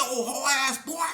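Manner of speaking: high loud mocking jeer; cocky yelled insult; confident taunt. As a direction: sneer it out with full confidence. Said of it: "he was confident".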